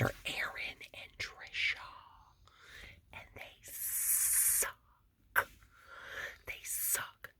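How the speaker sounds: soft secretive whisper, fading almost to nothing; hushed gossip about the neighbors next door. Real, not acted. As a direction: whisper the gossip so they won't hear. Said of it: "I can't really talk loud".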